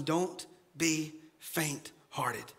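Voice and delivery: slow breathy whisper; whispered encouragement. Retold or imitated. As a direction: whisper courage close in. Isaiah's encouragement.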